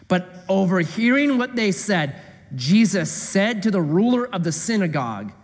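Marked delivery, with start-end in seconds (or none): none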